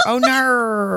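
a lightly put-on Australian oh no; Australian accent. Host doing her Australian accent for her co-host, a light Australian oh no. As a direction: say it with a mock Australian lilt. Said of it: "that's my Australian accent".

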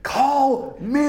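high, loud comic scream; exaggerated screaming of a star's outburst; mock scream.

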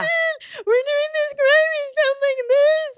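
very high-pitched wailing fan imitation; mock hysterical crying. Fans seeing [name] up close, hammed-up crying and screaming. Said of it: "We were crying, screaming".

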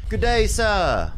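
slow, drawn-out Australian greeting, pitched high; a hammed-up Aussie hello, stretching out "day"; mock Australian accent. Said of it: "That's Australian".

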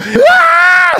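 very high mock scream; terrified scream. Son reenacts near plane crash with dad, terrified scream. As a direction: shriek in comic panic.

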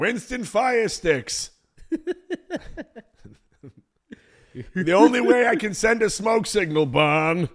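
loud, slow, hammed-up pitch; cartoon ad voice. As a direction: sell it like a cheesy commercial. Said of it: "What were they promoting".

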